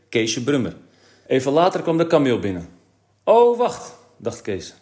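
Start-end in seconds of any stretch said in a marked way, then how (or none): 3.2-4.0 high, loud exclamation; startled, raised-pitch outburst; sudden realisation. A narrator's reading. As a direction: blurt out a dawning thought. Kees suddenly realises, inner thought.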